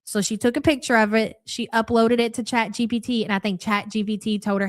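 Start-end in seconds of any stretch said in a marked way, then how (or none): none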